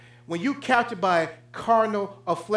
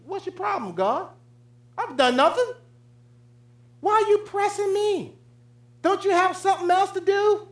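high, indignant mock complaint to God; mock indignant whine; 12 semitones higher than usual. Preacher mocks Israel's proud reply to God, high and indignant. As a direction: sneer at God like an offended brat. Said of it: "they sit there in front of Almighty God and said".

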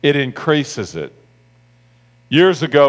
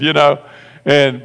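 usual speaking voice

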